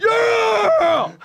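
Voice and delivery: high, loud yell; loud shout. Pretend. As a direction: scream it out. Screaming in the bit.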